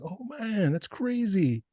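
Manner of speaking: high, softened mock astonishment; hushed high-pitched wonder, imitated; retold amazement. Retells own astonished reaction to discovery.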